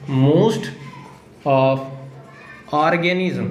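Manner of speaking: "most", "of", "organism", long pause after each; slow dictation. Shown as a demonstration. Teacher dictating a DNA definition to students, word-by-word dictation.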